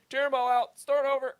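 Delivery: mock George voice. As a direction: pitch it way up, a mocking take on George. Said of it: "And then George would say".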